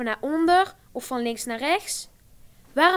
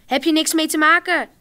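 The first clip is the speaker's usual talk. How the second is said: loud, higher snap back at her brother; shouting back; 6 semitones higher, 10 dB louder than usual. A narrator's reading. Rosa shouting back at Alexander from the bathroom, loud. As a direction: yell back through the door. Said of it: "roept ze terug".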